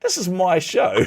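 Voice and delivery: high laughing indignation; mock indignation. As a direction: catch yourself, laughing and indignant.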